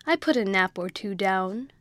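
usual speaking voice

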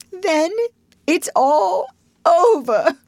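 high, halting, tearful wail; sobbing. A narrator's reading. Toad despairs of his home, sobbing. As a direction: break into despairing sobs. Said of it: "sobbed the Toad".

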